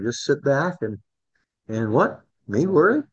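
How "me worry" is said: Alfred E. Neuman quip. Retold or imitated. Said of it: "You and Alfred E. Newman".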